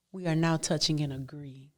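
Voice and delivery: soft whispered prayer phrase; whispered aside. Real, not acted. Preacher whispering a mock prayer agreement.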